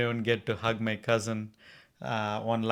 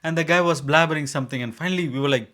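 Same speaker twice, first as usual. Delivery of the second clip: ordinary delivery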